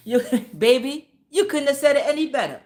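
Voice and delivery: excited praise. Real, not acted. Gushing reply to a commenter.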